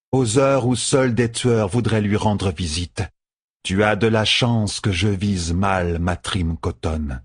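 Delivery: slow, measured woman's part; cool bodyguard. Acted. Seleucia warning Matt as intruder, coolly measured. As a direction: deliver the warning slowly and deliberately.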